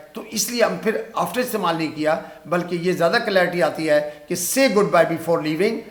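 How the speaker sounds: ordinary delivery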